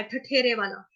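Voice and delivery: ordinary talk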